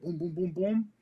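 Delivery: high-pitched string of booms; imitated gunfire. Soldier still engaging the fleeing truck, imitated gunfire. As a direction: mimic repeated shots climbing in pitch.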